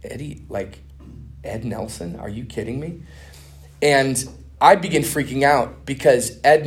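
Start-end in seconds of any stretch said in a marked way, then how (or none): 0.0-3.0 stunned disbelief. Retold or imitated. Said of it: "And I'm like".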